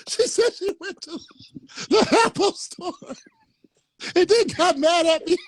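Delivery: words squeezed out through real high laughter; laughing while talking. Real, not acted. Host retelling friend's mix-up, laughing through words. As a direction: try to talk but keep laughing.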